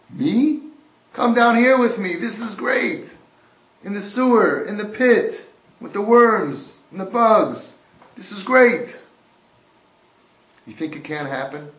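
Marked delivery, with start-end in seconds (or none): 0.1-9.1 loud, high, delighted yelling; a man yelling up that he loves the pit, loud and high; gleeful shout. Acted.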